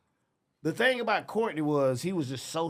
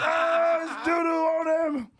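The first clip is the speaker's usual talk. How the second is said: high, slow, shouted, far above his usual voice; a crowd yelling in glee at the prank; mock crowd shout; 4 dB louder, slower than usual. Retelling the crowd's glee, a high hammed-up shout. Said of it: "Everybody like".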